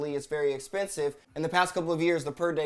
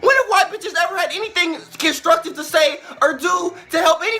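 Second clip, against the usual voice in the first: loud, high, shouted tirade; raging shout against the commercial; furious rant; 8 semitones higher, 10 dB louder than usual. Real, not acted. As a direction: rip into Pepsi at full volume. Angry rant at Pepsi ad.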